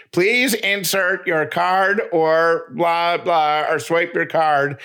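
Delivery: slow, high, drawn-out machine announcement; robot voice. Retold or imitated. Mom imitates the garage machine's slow robot voice. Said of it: "the robot voice is like".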